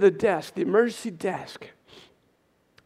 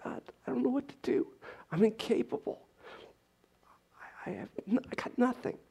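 high, soft plea fading into whisper; helpless prayer; 7 semitones higher, 6 dB quieter than usual. Retold or imitated. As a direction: plead softly to God with nothing left. Retold helpless night prayer to God.

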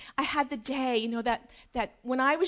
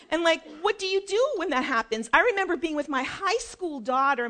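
ordinary talk, nothing marked

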